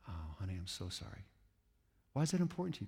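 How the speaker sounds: grandma soothing a hurt grandkid; tender grandma. Retold or imitated. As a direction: comfort the grandchild softly. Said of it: "what we have to say is".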